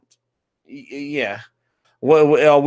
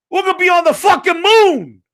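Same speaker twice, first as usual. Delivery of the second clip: much higher and louder, exasperated yell; incredulous outburst; 12 semitones higher, 5 dB louder than usual. Real, not acted. Gamer ranting at studios' long release times, exasperated high yell. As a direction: throw it up in disbelief, nearly shouting. Incredulous rant about tech years.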